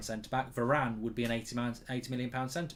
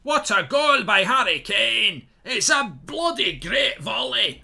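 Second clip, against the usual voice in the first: loud, slow, high Scottish commentary call; Scottish commentator; 11 semitones higher, 13 dB louder, much slower than usual. Acted. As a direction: keep the Scottish commentator's excitement going. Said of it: "I don't know why I'm saying speaking Scottish".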